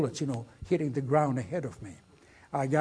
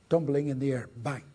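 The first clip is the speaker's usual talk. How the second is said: ordinary talk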